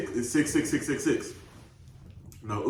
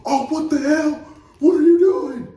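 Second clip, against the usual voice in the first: high mocking yell from the stands; crowd yelling; 11 dB louder than usual. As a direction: shout it like an angry crowd. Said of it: "everyone in the stands like".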